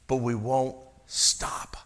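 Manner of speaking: slow breathy whisper, dropped right down. Real, not acted. Preacher to his congregation, a slow hushed whisper. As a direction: lean in and whisper the confession. Preacher's aside on never resting.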